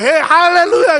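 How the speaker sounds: loud, high, joyous cry; excited hallelujah. Retold or imitated. Imitating a congregant shouting hallelujah, loud and high. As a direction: shout it out in celebration. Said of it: "이러고 들어가요".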